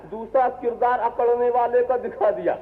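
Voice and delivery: ordinary talk, nothing marked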